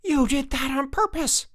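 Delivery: very high-pitched angry accusation; storming. A narrator's reading. Jack accusing Frank after being tripped, storming. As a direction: shriek the accusation indignantly. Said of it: "stormed Jack".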